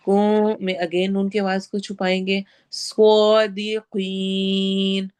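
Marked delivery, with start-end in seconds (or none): 2.7-5.1 slow, drawn-out tajweed chant; Quran recitation. Shown as a demonstration. Teacher recites verse for students, slow tajweed chant. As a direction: recite it slowly, stretching each sound. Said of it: "स्वाद heavy होगा".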